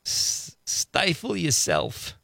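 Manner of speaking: slow, drawn-out Archie Bunker bark; a deliberately bad Archie Bunker. Host reads grouch text, bad Archie Bunker impression. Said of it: "my bad Archie Bunker".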